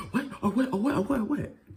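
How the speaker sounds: high-pitched, rapid-fire challenge, imitating him; mock confrontational. Mimicking the boy getting in my face, mock confrontational. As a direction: mimic someone squaring up, pitch jumping high.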